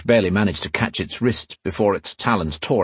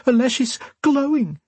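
put-on very high, childlike voice; tearful girl. A narrator's reading. Crying Sonia to Catherine about Alesh, tearful. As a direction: pitch it high like a girl near tears. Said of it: "the girl sniffed".

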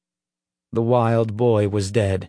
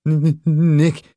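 stuttering; 4 semitones higher, 4 dB louder than usual. Acted. Leroy trying to get Nick's attention, stuttering. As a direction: stammer on the first sound, pitched up. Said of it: "Leroy stuttered".

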